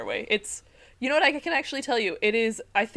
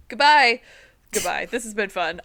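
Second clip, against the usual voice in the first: mock exit; 10 dB louder than usual. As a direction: fake storming off the podcast. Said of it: "I'm ending the podcast".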